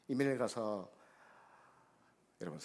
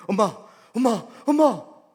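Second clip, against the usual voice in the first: excited calling; 12 semitones higher, 20 dB louder than usual. Retold or imitated. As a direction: shout for mother from the stairs, thrilled. Daughter runs down calling mother. (계단을 뛰어내려오며 엄마를 부르는 딸, 흥분한 외침)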